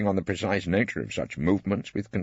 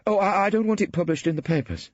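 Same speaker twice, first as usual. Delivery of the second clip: flustered, stammering and pitched higher; anxiously; 12 semitones higher than usual. A narrator's reading. Ballard alarmed at Phillida's jibe, anxious stammer. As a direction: panicky little protest. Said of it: "he said anxiously".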